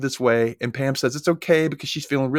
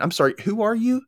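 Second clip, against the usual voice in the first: puzzled; 10 semitones higher than usual. Retold or imitated. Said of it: "Graham asks again".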